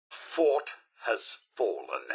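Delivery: slow, hushed announcement of defeat; defeated whisper. Acted. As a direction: let the bad news drop quietly. Robot announces the fort is lost.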